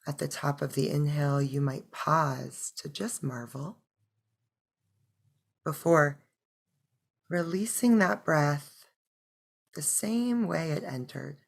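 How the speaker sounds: soft, unhurried meditation voice; hushed breath-by-breath guidance; guided meditation. Acted. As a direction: guide them gently into stillness, with long pauses.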